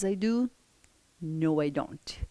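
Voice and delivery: normal talking voice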